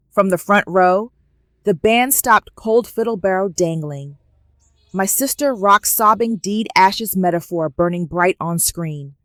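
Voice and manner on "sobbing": ordinary delivery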